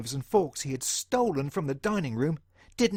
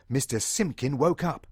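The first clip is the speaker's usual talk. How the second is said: plain reading voice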